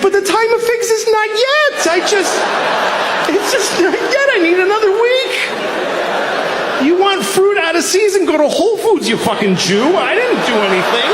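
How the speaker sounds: high, loud pleading tree; whiny tree voice. Acted. Talking tree defending itself to Jesus, high whine. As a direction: plead like a wronged little tree. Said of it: "And the tree's like".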